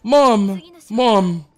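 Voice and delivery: put-on high, loud excited child voice; hammed-up kid calling his mom; kid yelling at mom. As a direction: shout it like a kid who found a secret. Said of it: "He's like".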